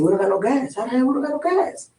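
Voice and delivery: can't believe the tank is empty; incredulous. Retold or imitated. Asking his friend in high disbelief why the car has no gas. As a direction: pitch climbing high on the second line in disbelief.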